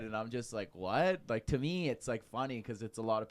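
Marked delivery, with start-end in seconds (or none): 0.7-1.2 baffled rising what; puzzled what, higher and louder; surprised. Retold or imitated. Retold baffled reaction to comments.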